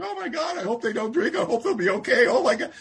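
high, hammed-up worried fretting; mock emotional. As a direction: imitate an anxious sponsor getting all worked up. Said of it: "get all emotional about sponsoring people".

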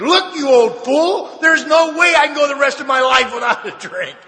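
high, loud exasperated outburst; retold scream. Drinker blowing up at his sponsor, screamed protest. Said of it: "And I screamed at him".

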